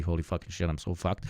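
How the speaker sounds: ordinary talk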